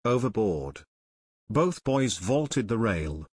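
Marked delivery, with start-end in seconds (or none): none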